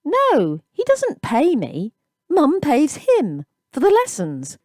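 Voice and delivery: put-on boy answering the bullies, bewildered; bewildered but polite. A narrator's reading. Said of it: "bewildered by the banter, but still anxious to be polite".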